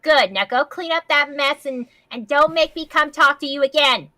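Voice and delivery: loud, high stern order; stern dismissal. Acted. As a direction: send him off with a firm warning. Principal dismissing the culprit.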